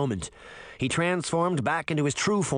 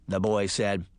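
ordinary delivery, nothing marked

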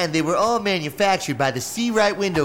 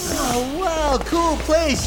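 excited; 11 semitones higher, much slower than usual. Acted. Kid awed entering the lab.

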